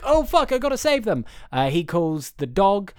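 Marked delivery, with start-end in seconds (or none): 0.1-1.2 panicked Woody. Retold or imitated. Friend retells the film, Woody panicking high. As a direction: voice jumps high in mock panic. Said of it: "Woody's like".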